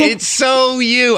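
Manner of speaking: high, slow gushing emphasis. Real, not acted. Host gushing to guest that the character is so her, high and slow. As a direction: stretch it out, delighted.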